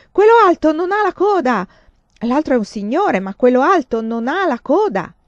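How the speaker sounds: high, loud, insistent yelling; shouting. A narrator's reading. Boy yelling to the guards about the tall man, shouting. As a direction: keep screaming the warning.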